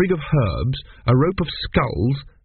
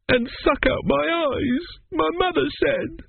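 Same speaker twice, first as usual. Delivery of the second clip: put-on very high, slow child's wail; tearful small boy; 3 dB quieter, slower than usual. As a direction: draw it out like a frightened, crying little boy. Sobbing Arthur continues his lament.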